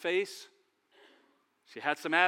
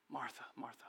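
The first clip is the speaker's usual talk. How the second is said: gentle, very soft; 6 semitones lower, 16 dB quieter than usual. Acted. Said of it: "Jesus was very gentle".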